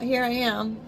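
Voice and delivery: ordinary delivery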